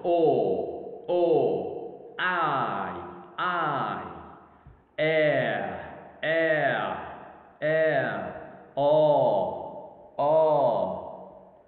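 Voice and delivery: "O", "I", "air" each held long and alone, falling; isolated syllables. Shown as a demonstration. Teacher demonstrating one-syllable words, each drawn out alone. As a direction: draw out each single sound, "O", "I", "air", with pauses between. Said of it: "consist of one syllable".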